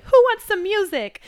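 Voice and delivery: brightly change the subject to defuse the tension; cheerful mock-innocent offer of music; chirpy deflecting relative. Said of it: "another person that's like".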